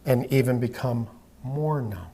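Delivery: slow, soft, even hypnotist voice; hypnotic induction. Acted. Hypnotist guiding a trance.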